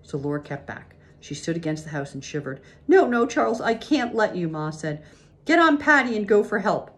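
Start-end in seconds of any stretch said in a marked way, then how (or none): 2.9-4.5 high, urgent pleading; alarmed wife forbidding it; frantic refusal. A narrator's reading. Ma refuses to let Pa go. 5.4-6.9 high, raised voice urging help; anxious, insistent wife; urgent pleading. A narrator's reading. Ma urges Pa to get help.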